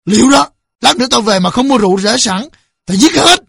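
high, forceful final threat; menacing threat. A narrator's reading. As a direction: threaten them as you storm off. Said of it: "nói bằng giọng đe dọa".

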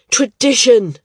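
Tradition blurted out loud and high; desperate. A narrator's reading. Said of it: "he said, sounding desperate".

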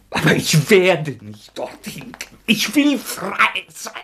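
cling to freedom desperately; defiant cry. Acted. Rodja refusing prison to Sonja, slow defiant cry.

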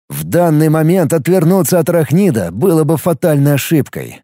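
plain reading voice

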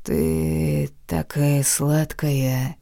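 slow, low husky whisper. Acted. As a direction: breathe it hoarsely, slowly. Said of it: "хрипло прошептал".

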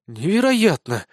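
understated astonished exclamation; breathed amazement. A narrator's reading. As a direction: exhale the word in disbelief. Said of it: "выдохнула Безос". (изумление на выдохе)

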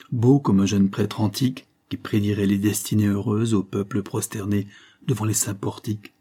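ordinary delivery, nothing marked

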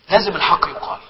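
normal talking voice

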